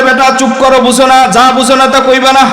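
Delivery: high mock rebuke; scolding. Pir scolds the disciple, mock high rebuke. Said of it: "বাবা ধমক দিয়ে বলে".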